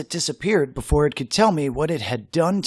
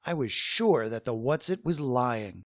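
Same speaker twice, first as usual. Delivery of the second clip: plain reading voice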